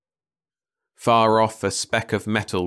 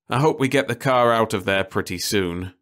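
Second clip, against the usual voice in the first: plain reading voice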